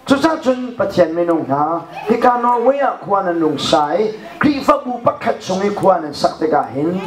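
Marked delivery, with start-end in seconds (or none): none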